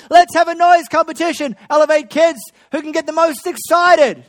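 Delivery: loud, high, exuberant welcome; excited shout. Real, not acted. Pastor exuberantly welcoming kids.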